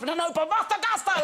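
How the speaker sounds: put-on very high child impersonation; mock a toddler's squealed demand; insistent toddler. Daughter refuses dad's film choice, insistent toddler.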